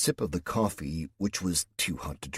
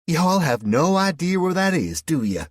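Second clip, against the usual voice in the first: put-on high, loud Southern-accented teasing; mock him with a big high Southern twang; 11 semitones higher, 9 dB louder than usual. A narrator's reading. West Virginian woman teases the clueless Englishman, mocking. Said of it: "she asked mockingly".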